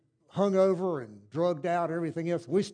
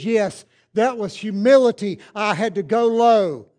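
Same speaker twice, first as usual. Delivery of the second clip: louder, slow preaching; 2 semitones higher, 11 dB louder, slower than usual. Real, not acted. Preacher declares to congregation, louder and slow. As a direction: drive home humility.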